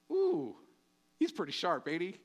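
ordinary delivery

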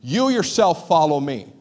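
slow, stressed You; emphatic command. Retold or imitated. Jesus rebuking Peter, slow and emphatic. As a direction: lean hard on "You yourself". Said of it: "he says emphatically".

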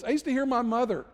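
ordinary delivery

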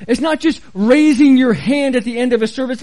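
usual speaking voice

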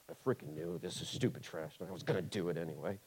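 husband taking out the trash, grumbling very low and soft; grumbling mutter. Retold or imitated. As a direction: mutter it under your breath, fed up. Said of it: "you're like".

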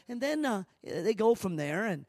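ordinary delivery

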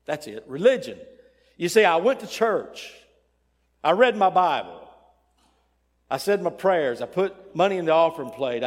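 loud, high-pitched list of good deeds; acted protest of a religious man; self-righteous insistence. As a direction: punch out each deed as proof. Third man lists religious deeds.

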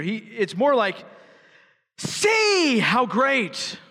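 a slow, rising exclamation on great; exclaimed. Shown as a demonstration. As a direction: read "See how great" as if it ends in an exclamation mark. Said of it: "Read it with an exclamation point".

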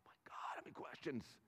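whispered under the breath, exasperated. Retold or imitated. Dad worn down by his son's questions, whispering in exasperation. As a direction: mutter it to yourself, worn out. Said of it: "getting frustrated the other day going".

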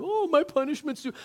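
a sulky mock wail of self-pity; whiny victim. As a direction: whine it like a sulking victim. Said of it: "he goes into victim mode".

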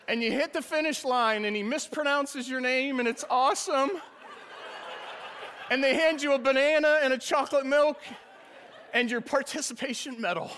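high, loud, laughing through the story; can barely hold back the giggles. Real, not acted. Pastor laughs while telling the congregation about the finish line, high and loud.

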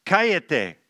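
"Cállate" said plainly as a general hush; "Cállate" with no pronoun, to the whole group; Spanish "Cállate" aimed at all the children; plain Spanish command. Shown as a demonstration. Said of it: "I might say to them".

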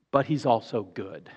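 ordinary talk, nothing marked

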